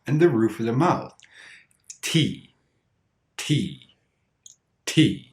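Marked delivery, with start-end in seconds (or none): 2.0-5.3 T with strong tongue contact; normal T. Shown as a demonstration. Teacher showing learners a normal T sound, firm, crisp contact. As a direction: say "T" with the tongue firmly striking the roof of the mouth. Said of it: "A normal T sound has strong contact".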